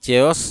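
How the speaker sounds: चेयस starting with 'ch' as in chair; wrong pronunciation. Shown as a demonstration. Teacher quoting how people wrongly say chaos, mispronounced. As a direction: say चेयस, chay-vus. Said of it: "उसको गलत से लोग बोलते हैं".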